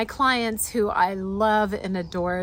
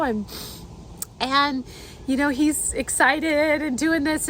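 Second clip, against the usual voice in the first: ordinary delivery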